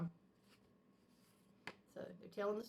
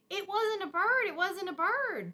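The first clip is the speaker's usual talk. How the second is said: eager confession; 9 semitones higher, 7 dB louder than usual. A narrator's reading. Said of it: "said sister".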